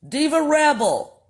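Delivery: "Diva" jumps up high, then falls; high announcing. Shown as a demonstration. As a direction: call out "Diva Rebel" high and sing-song. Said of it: "What is this young lady's name?".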